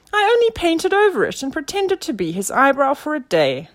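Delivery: very high, loud little creature voice; indignant tiny caterpillar squeak. A narrator's reading. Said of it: "squeaked the eyebrow".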